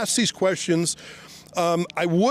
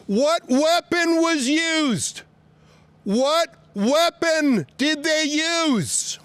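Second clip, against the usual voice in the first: slow, higher, raised voice calling across; 8 semitones higher, much slower than usual. Real, not acted. Reporter calling questions to police in their cars, raised voice. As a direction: project each word at the closed car.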